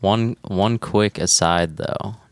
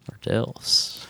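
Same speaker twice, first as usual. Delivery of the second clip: softer, whispered repeat of the punchline; whispered echo; 5 semitones higher, 5 dB quieter than usual. Real, not acted. Echoing a co-host's joke, whispered. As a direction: breathe it back as a sly aside.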